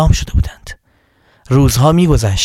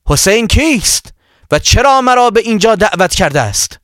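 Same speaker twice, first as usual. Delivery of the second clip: calling out; 7 semitones higher than usual. A narrator's reading. Wahb hails the caravan asking who Hussein is, calling out. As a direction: call out in a raised pitch to a whole camp. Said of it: "فریاد زد".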